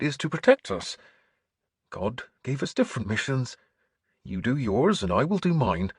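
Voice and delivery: lifted, earnest priestly voice; earnest bishop. Acted. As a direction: explain your calling as a kindly bishop. Bishop explains his mission.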